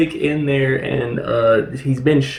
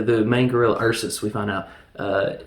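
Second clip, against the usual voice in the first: ordinary talk, nothing marked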